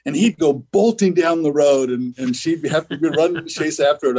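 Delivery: holding back real laughter while telling it; trying not to laugh. Real, not acted. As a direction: fight down a laugh as you talk. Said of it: "I'm trying not to laugh".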